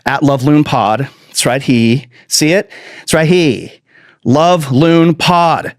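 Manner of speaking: Love Loon Pod drawn out very slowly; slow mock spelling-out. Shown as a demonstration. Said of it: "Is that too many syllables for you?".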